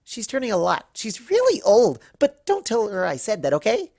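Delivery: high, fast, bubbly pony chatter; Pinkie Pie voice. Acted. Pinkie invites Rarity to a party, high and breathless. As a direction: gush it out like an excited party pony. Pinkie whispers gossip about Luna.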